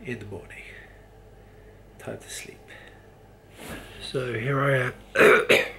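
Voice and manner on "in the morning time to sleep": whispering. Real, not acted. Signing off to sleep.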